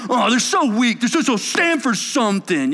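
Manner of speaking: lightly voiced disdain; mock scornful critic. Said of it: "Wimpy people".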